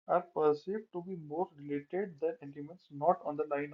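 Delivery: slow, careful reading from a slide; slow text reading. Real, not acted. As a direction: read it word by word. Reads slide text aloud. (धीरे-धीरे पढ़ना)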